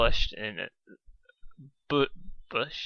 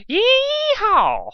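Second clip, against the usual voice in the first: high, very loud whooping yee-haw; 10 dB louder than usual. A narrator's reading. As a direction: whoop it out like a cowgirl. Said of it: "he heard a very loud".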